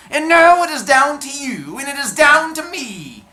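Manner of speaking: high, loud gloating dwarf; hunchback dwarf voice. Acted. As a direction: crow over the rival, high and loud. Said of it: "my little hunchback dwarf voice".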